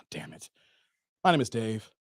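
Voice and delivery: low, soft, reluctant reply; grudging homeowner. Acted. Dave answering the Jehovah's Witness, low and reluctant. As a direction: give your name grudgingly, down low.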